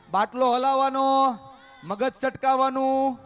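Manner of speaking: loud, high rallying preacher voice; fiery preaching. Acted. Speaker rousing the gathered villagers, fiery preaching. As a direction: whip the crowd up, full force. Rallies crowd in call-and-response.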